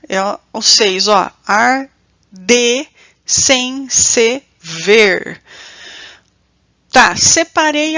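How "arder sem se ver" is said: "arder sem se ver" very slow, ar, de, sem, se, ver; a long gap after each syllable of "arder sem se ver". Shown as a demonstration. Teacher counting out the verse's metre, syllable by syllable. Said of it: "primeiramente separar as sílabas".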